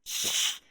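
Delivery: long unvoiced shush; drawn-out shh. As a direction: hush them to stop talking. Acted hushing of the other character.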